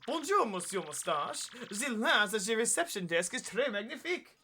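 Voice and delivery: theatrical Dupree voice with French phrases; swooping, hammed-up French dandy; flamboyant French character. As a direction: play a pompous French admirer. Voices French-speaking game character.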